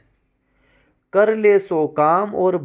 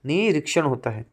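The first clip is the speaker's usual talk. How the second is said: normal talking voice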